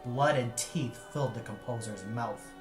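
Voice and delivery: ordinary delivery, nothing marked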